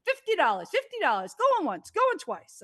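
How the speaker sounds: rising auctioneer call, going once, going twice. Pretend. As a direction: play the auctioneer, building up. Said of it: "I feel like an auctioneer".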